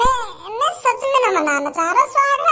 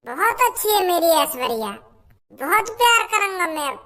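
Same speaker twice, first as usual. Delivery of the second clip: calm man speaking lower; a lower voiced man soothing, then fond; 6 semitones lower than usual. Calming the angry brother, lower man's voice.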